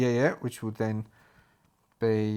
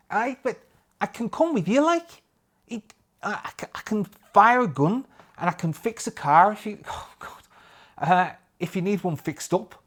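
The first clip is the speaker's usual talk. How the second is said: much higher, eager put-on voice; high pleading Jack; 9 semitones higher, 4 dB louder than usual. Jack begs Staff to let him come, in a high eager voice. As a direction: beg to come along, pitched up and eager.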